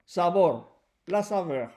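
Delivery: ordinary talk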